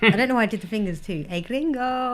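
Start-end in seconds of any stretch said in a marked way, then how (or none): 1.3-2.1 mock Mexican accent. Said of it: "What else do they say in Mexican".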